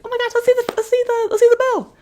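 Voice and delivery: put-on very high excited kid voices; high-pitched excited kids; squeaky kids. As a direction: gush like eager little kids.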